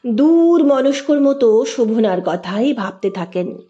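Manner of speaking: ordinary delivery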